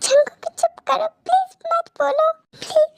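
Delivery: high, crying plea; tearful pleading. Acted. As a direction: beg him through tears. Woman tearfully begs him to stop.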